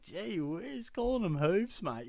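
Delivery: high-pitched incredulous objection; mock taken aback. Storyteller objecting to the bloke about hooves, in high mock disbelief. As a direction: act out being taken aback by rudeness. Retelling shock at rude remark.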